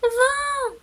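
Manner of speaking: high squeaky little mouse cry. A narrator's reading. Little mouse calling up to the wind, high and squeaky. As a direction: call the wind in a tiny high voice. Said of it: "s'écria le souriceau".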